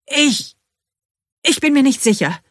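high, loud, strained stammer; forced out. A narrator's reading. Narrator to the workman, unsure about the figure, forced out. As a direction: squeeze the words out at a high pitch. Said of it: "presse ich hervor".

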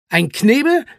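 echoed question. Acted. Zwolle prepares to explain the gag, echoing the question. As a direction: repeat it briskly as a question.

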